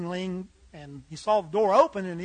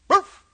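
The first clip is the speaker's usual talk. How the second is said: high, loud single woof; dog bark; 11 semitones higher, 11 dB louder than usual. Acted. Dog picking steak from the choices, barking. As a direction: bark a sharp yes.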